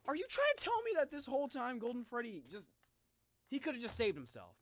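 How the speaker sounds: ordinary talk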